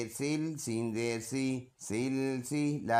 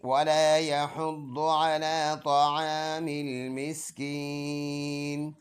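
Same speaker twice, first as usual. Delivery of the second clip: melodic recitation, louder, with a slow drawn-out Miskin; Quran recitation; 2 semitones higher, 3 dB louder, slower than usual. Shown as a demonstration. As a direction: chant the verse fully and stop on Miskin. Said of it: "full आयत ऐसे पढ़ेंगे". (पूरी आयत तिलावत के अंदाज़ में, मिस्कीन पर रुककर)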